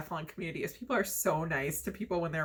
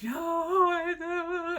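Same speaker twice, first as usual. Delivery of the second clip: high, crying denial; blubbering; 11 semitones higher, much slower than usual. Retold or imitated. Telling worried family she isn't hurt, high and sobbing. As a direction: sob it out. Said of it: "I'm just, like, a blubbering mess".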